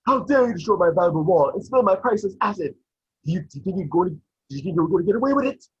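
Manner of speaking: high-pitched, indignant scolding; Burns. Acted. Boss scolds worker over his ruined wall, angry scolding. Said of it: "getting scolded by Burns".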